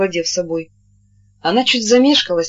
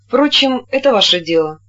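ordinary delivery, nothing marked

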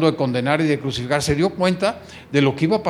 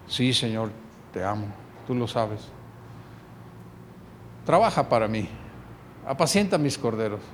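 much lower and softer, intimate; tender exchange; 6 semitones lower, 8 dB quieter than usual. Acted. Peter answers the risen Jesus softly, tenderly. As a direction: speak it gently, like a confession.

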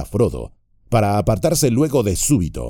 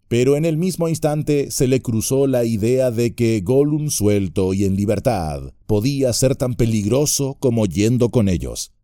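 ordinary delivery, nothing marked

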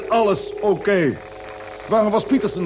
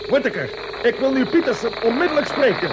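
impatient command; 5 semitones higher than usual. Acted. Captain demands Peterson again.

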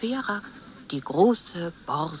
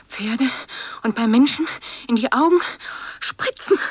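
starts whispered, grows much louder; whisper building to shout; 6 semitones higher, 5 dB louder than usual. Acted. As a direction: swell from a hiss to full voice. Whisper swelling into loud speech.

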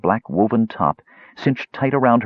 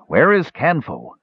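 put-on gruff old fisherman; 9 semitones higher, slower than usual. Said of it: "asked the old fisherman in a low rumble".

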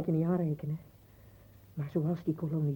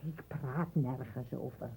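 zacht; 5 semitones lower, 10 dB quieter, slower than usual. Acted. Vrouw trekt zich terug.

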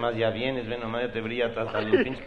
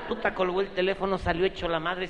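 usual speaking voice

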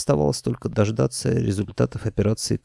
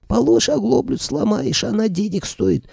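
high shrill trader voice; 12 semitones higher than usual. Acted. Another trader interrupts loudly.

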